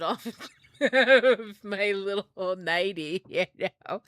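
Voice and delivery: slow, loud words through real laughter; helpless giggling while speaking, drawn out; laughing through words. Real, not acted. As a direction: crack up mid-sentence and keep talking. Breaks up laughing while telling it.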